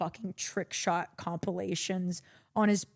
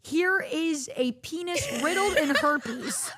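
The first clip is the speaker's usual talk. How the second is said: mock teacher; 7 semitones higher, slower than usual. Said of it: "They're like".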